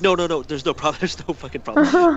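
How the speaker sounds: smooth it over with mock panic; retold frantic placating. Retelling hastily placating the guard.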